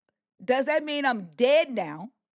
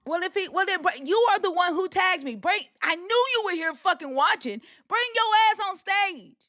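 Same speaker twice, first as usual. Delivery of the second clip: challenging call-out; 8 semitones higher than usual. Real, not acted. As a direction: dare him to come up, voice raised. Calling out troll watching the stream.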